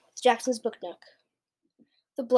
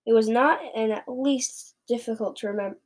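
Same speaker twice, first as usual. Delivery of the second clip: plain reading voice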